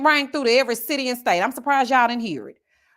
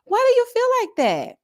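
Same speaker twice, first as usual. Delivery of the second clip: mocking the clerk; 12 semitones higher than usual. Imitating the profiling clerk's question in a high mocking voice. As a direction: put on a high fake-innocent voice. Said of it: "So she goes".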